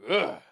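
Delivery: short, loud, high "ugh" noise; demonstrated slicing noise. Shown as a demonstration. Streamer showing how the thumb got sliced, sudden loud noise. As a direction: punch out a sudden cutting sound. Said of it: "like that".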